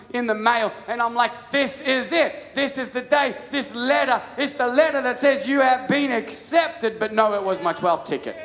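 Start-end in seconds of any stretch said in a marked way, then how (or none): 1.5-7.0 high, loud, slow thrill at the letter; mock triumphant this-is-it; excited anticipation. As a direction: savour the big moment, voice up high. Imagined joy at acceptance letter.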